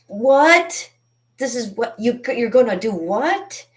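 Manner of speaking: high, loud "what?!" of disbelief; incredulous outburst at turning fifty; shocked disbelief. Retold or imitated. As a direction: gasp out the question in mock horror.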